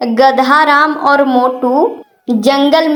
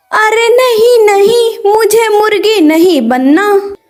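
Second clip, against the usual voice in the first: much higher, panicked pleading; high frightened fox begging to be spared; scared fox; 8 semitones higher than usual. Acted. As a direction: back off in a fright, voice jumping up.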